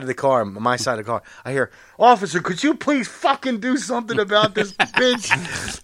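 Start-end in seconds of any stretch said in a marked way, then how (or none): 2.0-5.8 mock irate driver. Driver behind calls to cop.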